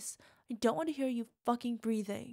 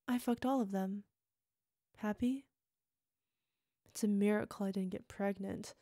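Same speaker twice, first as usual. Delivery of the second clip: normal talking voice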